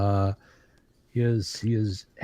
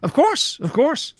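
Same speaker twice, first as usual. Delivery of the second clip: high, eager merchant voice; obliging trader, pitched up; 13 semitones higher than usual. Acted. Trader NPC answering again.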